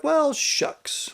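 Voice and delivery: high, aw-shucks lament; hammed-up folksy letdown; mock disappointment. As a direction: pitch it way up, playfully let down. Acted reply to being refused.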